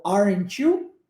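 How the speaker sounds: "Aren't you?" joined as "aren-chew", rising; T as tch. Shown as a demonstration. Teacher sums up the T plus Y rule with "Aren't you?", T as tch. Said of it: "a próxima palavra começando com esse som de Y".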